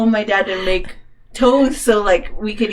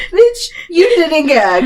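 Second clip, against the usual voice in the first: high, drawn-out mock accusation; drunk indignation; 7 semitones higher, 4 dB louder, slower than usual. Drunk friend faults her sick pal, high indignant drawl. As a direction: complain like an affronted drunk.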